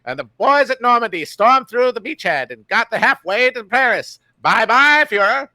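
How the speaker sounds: put-on high old newsreel announcer; mid-Atlantic accent. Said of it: "mid-Atlantic accent guy".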